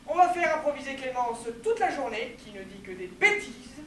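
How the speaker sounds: usual speaking voice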